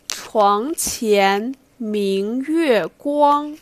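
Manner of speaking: teacher modelling a Tang poem for repetition; slow recital model. Shown as a demonstration. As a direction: recite each line slowly and clearly for the class to echo. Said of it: "ask you guys read after me".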